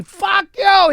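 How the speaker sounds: high, loud mock shout at the umpire; a hammed-up player blowing up at the ump; angry yell at the ump. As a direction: ballplayer screaming at the umpire over a called strike. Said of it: "Strike me".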